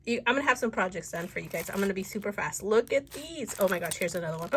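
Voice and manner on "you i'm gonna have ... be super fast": ordinary talk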